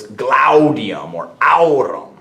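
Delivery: "glaudium or aurum" with ow vowels. Shown as a demonstration. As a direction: open "glaudium" and "aurum" with an ow sound. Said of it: "is pronounced ow".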